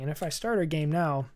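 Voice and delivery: normal talking voice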